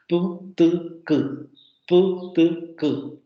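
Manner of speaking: "Pu, tu, ku" popped; "Pu, tu, ku" as clean plosives; demoed stop series. Shown as a demonstration. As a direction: show all three are stops. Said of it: "tres fonemas son oclusivos".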